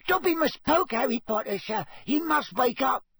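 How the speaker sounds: squeaky, high-pitched house-elf urging; Dobby. A narrator's reading. Elf waking Harry in the library, squeaking urgently. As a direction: squeak it urgently, far above normal pitch. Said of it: "squeaked Dobby".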